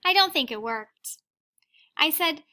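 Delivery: put-on reading a picture book aloud to children; gentle read-aloud storytelling; teacher reading to the class; story reading. A narrator's reading.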